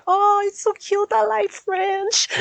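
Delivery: high, loud, drawn-out squeal of delight; imitated gushing. Locals delighted by a newcomer's French accent, high gushing imitation. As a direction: gush slowly in a very high, excited voice.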